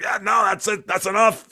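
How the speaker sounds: a half-started put-on German voice, pitched high; mock German voice. Cheering Germans on, he slips into a high mock voice. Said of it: "I won't do a Germ-, bad German accent".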